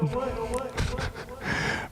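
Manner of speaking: a high, light laugh at himself; short high chuckle. Real, not acted. Laughs at own realization.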